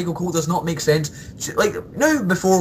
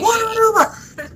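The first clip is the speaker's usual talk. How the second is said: high, loud hammed-up announcer cry; Vince McMahon impression; 3 dB louder than usual. Fan imitating Vince McMahon's famous call, high hammed-up cry. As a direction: belt it like an over-excited chairman. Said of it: "Vince McMahon was awesome".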